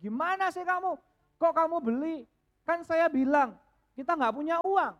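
high, loud, angry husband; angry husband yelling. Retold or imitated. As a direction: shout it at the wife in anger. Suami marah ke istri. (suami marah berteriak)

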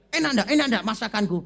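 loud, fast, eager cook demanding praise; pushy question. Retold or imitated. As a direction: fire it off loudly, fishing for a compliment. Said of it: "Jadi ngomong". (tanya keras dan cepat minta dipuji)

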